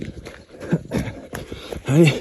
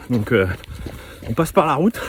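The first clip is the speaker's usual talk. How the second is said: ordinary talk, nothing marked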